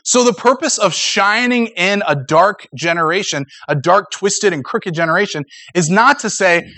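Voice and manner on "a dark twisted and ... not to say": ordinary talk, nothing marked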